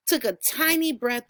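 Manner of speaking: ordinary delivery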